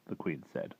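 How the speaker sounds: usual speaking voice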